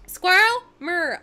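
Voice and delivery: loud, high American "Squirrel? Mirror."; delighted, exaggerated American accent imitation. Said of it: "favorite things to hear Americans say".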